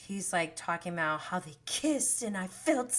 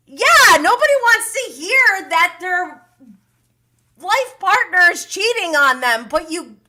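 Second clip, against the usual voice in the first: much higher and much louder, incredulous; shrill-high, loud, indignant rant; outraged outburst; 9 semitones higher, 12 dB louder than usual. Real, not acted. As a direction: yell it at the screen in disbelief. Outraged reaction to his excuse.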